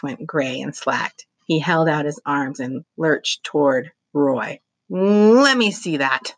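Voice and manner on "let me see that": higher, slow demanding grab; demanding Chuck. A narrator's reading. Chuck lunging at Roy, demanding the camera. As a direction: insist on seeing it.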